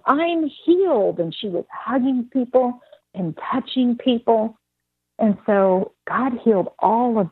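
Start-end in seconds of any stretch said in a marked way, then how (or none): 0.1-1.2 high, drawn-out retold cry; mock joyful shout. Healed woman telling everyone, mock rejoicing shout. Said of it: "she was shouting".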